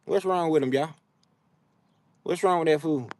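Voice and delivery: usual speaking voice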